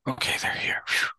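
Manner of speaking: whispered sigh of relief; relieved whisper. Retold or imitated. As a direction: breathe it out, relieved the money's there. Mom relieved, money found.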